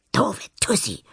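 unvoiced spiteful hiss; hissed whisper. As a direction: hiss it under your breath. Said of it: "zischt Anna".

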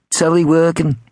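high-pitched Liverpudlian caller. A narrator's reading. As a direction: quiz them in a high Liverpudlian accent. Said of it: "in his best Liverpudlian".

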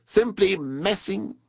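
high, slow, drawn-out reverie; dreamy. A narrator's reading. Rat rows on, forgetting to look, dreamily. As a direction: drift off into a daydream. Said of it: "he went on dreamily".

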